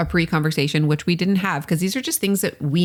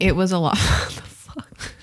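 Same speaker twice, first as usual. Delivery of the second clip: laughing; 3 semitones higher than usual. Real, not acted. Laughing at embarrassing memory.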